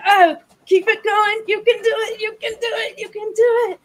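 rally them like a trainer; encouraging coach. Acted. Cheering viewers through exercise.

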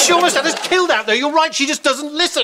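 high, fast, outraged accusation; agitated complaint. Acted. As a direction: burst out complaining, pitched high. Acted quarrel after the drive.

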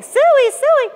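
high, loud two-note hog call; pig call. Shown as a demonstration. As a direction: call the pigs in from across the yard. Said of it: "when you want to call a pig".

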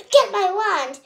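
loud urgent yell. A narrator's reading. Missus Presto yelling to Doctor Odd, loud urgent. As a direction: bark the order. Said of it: "Missus Presto yelled".

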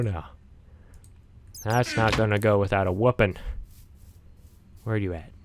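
normal talking voice

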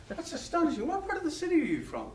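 high and quick, breathless; astonished Irishman. Retold or imitated. Irishman amazed in a pub, high, quick and eager. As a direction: rush the next question, amazed. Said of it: "getting more and more excited".

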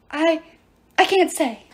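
high, halting dwarf voice; stammering. A narrator's reading. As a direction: stammer it out helplessly. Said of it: "Pasalos stammered".

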